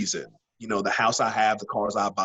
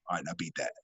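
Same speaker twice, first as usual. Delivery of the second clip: much softer, low challenge; soft, cocky dare; quiet taunt; 5 semitones lower, 10 dB quieter, much faster than usual. Retold or imitated. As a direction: dare them softly, lower and understated. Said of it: "and go".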